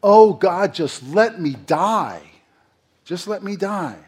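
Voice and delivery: loud, high wail of a man begging to die; despairing Jonah. Retold or imitated. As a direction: moan it out as a worn-out prophet. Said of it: "he's like".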